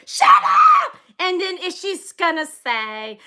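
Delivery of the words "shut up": sudden screaming outburst, very high and loud; shrieking "Shut up!" out of nowhere; sudden shout. Acted. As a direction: blurt it out like an uncontrollable tic.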